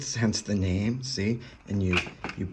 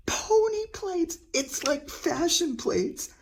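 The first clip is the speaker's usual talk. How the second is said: slow, much higher squeal of joy; squealing delight; slower than usual. Real, not acted. Collector squealing to viewers over pony plates, giddy. Gushing over a found childhood toy.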